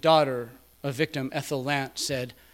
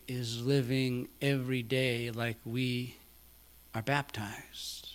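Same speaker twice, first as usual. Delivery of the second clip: hushed whisper; 4 semitones lower, 7 dB quieter than usual. Acted. Quiet moment in sermon.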